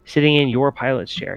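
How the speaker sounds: normal talking voice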